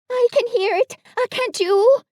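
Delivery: high, loud, nervous squeak; anxious. A narrator's reading. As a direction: ask for reassurance in fear. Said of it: "said Piglet anxiously".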